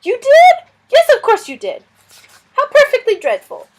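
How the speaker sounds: high, loud, fretful lizard voice; a flustered little lizard fretting over a guest; fussy lizard. Acted. Lizard Suz fussing about the stranger, high and loud.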